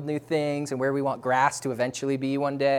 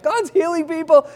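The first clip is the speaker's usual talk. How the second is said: excited cry; 13 semitones higher, 7 dB louder than usual. Retold or imitated. Group praying like crazy.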